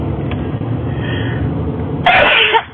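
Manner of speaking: a real sneeze bursting out; sneezing. Real, not acted. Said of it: "Excuse me, I have to sneeze".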